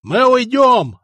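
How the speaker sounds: angry yell from a crowd; angry shout. Acted. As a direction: shout it out in anger. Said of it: "раздался злой голос из толпы".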